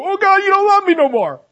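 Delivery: high, loud mock whine; whiny complaint. Paul imagined complaining to God in prison, high loud whine. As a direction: wail it like a self-pitying prisoner.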